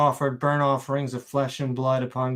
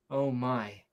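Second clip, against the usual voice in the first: ordinary delivery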